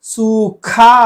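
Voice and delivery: सूखा slow, loud puff on खा; forceful aspiration. Shown as a demonstration. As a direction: stretch "soo-KHAA" in सूखा. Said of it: "जोर देकर".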